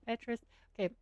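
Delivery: ordinary talk, nothing marked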